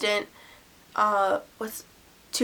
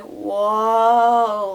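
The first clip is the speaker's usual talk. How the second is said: drugged awe; 6 dB louder than usual. Retold or imitated. High on pain reliever.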